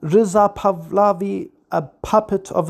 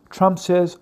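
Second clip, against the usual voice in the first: normal talking voice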